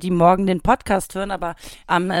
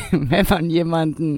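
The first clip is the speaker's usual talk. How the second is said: ordinary talk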